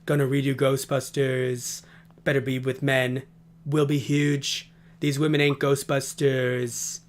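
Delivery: low, slow impression with drawn-out endings; Donald Trump. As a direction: drag it out low in a mock presidential voice. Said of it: "I'm gonna do a Trump voice".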